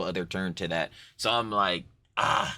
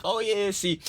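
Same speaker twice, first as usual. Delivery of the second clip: geoguesser know-it-all; 7 semitones higher than usual. Acted. Streamer spoofing map sleuths working out a location, mock-expert geoguesser voice. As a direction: put on an eager geoguesser, pitched up at first. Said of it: "the geoguesser bull".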